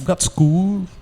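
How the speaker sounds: high, loud mock Cockney; mimicked Cockney accent. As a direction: tease with an exaggerated Cockney imitation.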